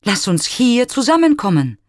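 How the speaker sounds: high, loud invitation; calling out. A narrator's reading. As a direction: call out hopefully into the woods. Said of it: "spricht er".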